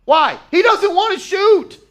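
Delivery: high, loud exasperated cry; can't believe he won't shoot. Real, not acted. Exasperated at passive Knicks player.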